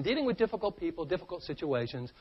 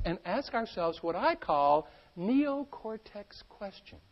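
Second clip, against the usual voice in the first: ordinary talk, nothing marked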